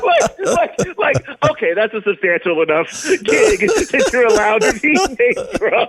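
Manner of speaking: high-pitched, cracking up mid-story; laughing while talking. Real, not acted. Drummer retells a funny snub to the host, laughing. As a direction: talk through real giggles.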